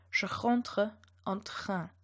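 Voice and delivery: "Je rentre en train" slowly, uvular R in "rentre" and "train"; slow demo of "Je rentre en train" with French R. Shown as a demonstration. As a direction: drag it out, throaty R each time. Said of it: "So the first sentence would be pronounced".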